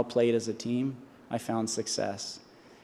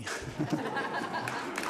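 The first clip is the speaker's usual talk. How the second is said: breathy laugh; 5 semitones higher, 7 dB quieter than usual. Real, not acted. Laughs after thanking.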